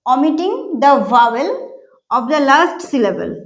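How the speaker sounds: slow, word by word, omitting the vowel; slow dictation. Shown as a demonstration. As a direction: dictate it slowly for notes. (ধীরে শ্রুতিলিখনের মতো বলা)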